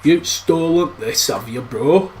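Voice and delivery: slow, lightly voiced Yorkshire Hagrid; a hint of Yorkshire giant drawling it out; Yorkshire accent. Teasing Harry as Hagrid in a light Yorkshire accent. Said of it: "with a Yorkshire accent going".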